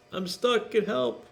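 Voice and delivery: high little plea; squeaky sign voice. Acted. Teacher reads the stuck cat's sign in a high voice.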